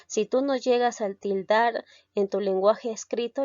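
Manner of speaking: ordinary talk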